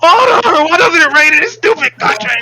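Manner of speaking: high, wailing shout begging for rain; hammed-up desperate screaming; desperate plea. Player acts desperate plea for rain.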